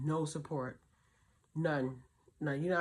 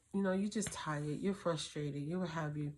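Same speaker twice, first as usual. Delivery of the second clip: ordinary talk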